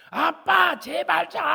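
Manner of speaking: high, loud whiny daughter voice; a sleepy daughter snapping at dad; annoyed daughter. Retold or imitated. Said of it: "잠자던 딸이 뭐라고 하는지". (잠이 깬 딸의 짜증 섞인 높은 목소리)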